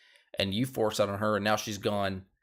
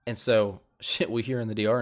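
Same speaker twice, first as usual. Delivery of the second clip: ordinary talk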